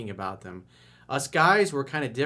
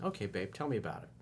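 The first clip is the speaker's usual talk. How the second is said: put-on quiet, gentle boyfriend; soft and reassuring; 2 semitones lower, 8 dB quieter, faster than usual. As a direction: comfort her softly. Boyfriend comforting girlfriend.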